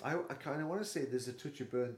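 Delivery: mic right against the mouth; talking straight into the microphone; too close to mic. Real, not acted. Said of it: "Whoops. I'll put the microphone in my mouth".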